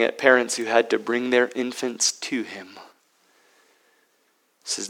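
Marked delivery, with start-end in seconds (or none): none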